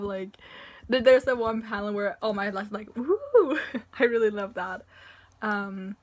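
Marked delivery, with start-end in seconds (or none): 3.0-3.6 high, loud whooping cheer; imitated "woo!". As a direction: whoop it out, mimicking a gleeful reaction. Imitates All Might's reaction in panel.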